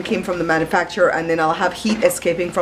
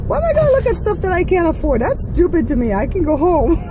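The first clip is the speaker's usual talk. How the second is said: excited; 10 semitones higher than usual. Real, not acted. Laughing about hating shopping.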